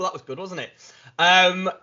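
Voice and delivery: ordinary talk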